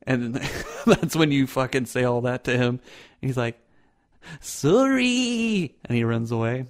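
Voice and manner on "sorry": high squeaked Canadian sorry; a frightened Canadian halfling yelping sorry; Canadian apology. Acted. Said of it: "They're supposed to be Canadian".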